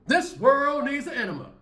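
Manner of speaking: high, loud outburst; shouted outburst. Real, not acted. Ranting vlogger to viewers, shouted outburst. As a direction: blurt it out at full pitch.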